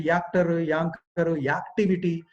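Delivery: actor, anchor, activity with a 'ya' glide in front; common mispronunciation. Retold or imitated. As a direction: start each word with "yaa", as in "yaktivity". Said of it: "I've seen many people saying".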